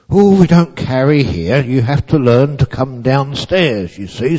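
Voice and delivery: firm, no-nonsense carer; stern voice one. A narrator's reading. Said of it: "said voice number one".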